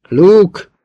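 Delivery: high, loud single call; shouting. A narrator's reading. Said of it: "kiáltotta újból". (hangos kiáltás)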